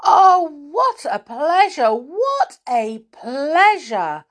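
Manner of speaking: high, loud, drawn-out exclamation; hammed-up storybook joy; delighted cry. A narrator's reading. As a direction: gush with delight at visitors. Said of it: "cried Jack".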